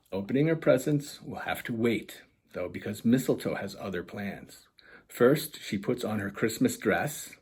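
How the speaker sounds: lively storybook reading voice; animated children's book narration; story narration. A narrator's reading. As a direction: read it like bedtime picture book. Author reads picture book aloud.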